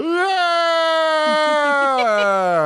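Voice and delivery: shrieking laugh; high shrieking laugh. Real, not acted. Laughing at own bit.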